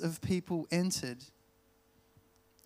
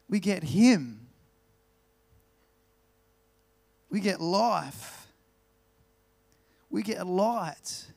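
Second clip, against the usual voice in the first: louder, slower, building preaching; preaching at full force; 4 semitones higher, 5 dB louder than usual. Acted. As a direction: drive the point home from the pulpit.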